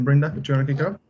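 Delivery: ordinary talk, nothing marked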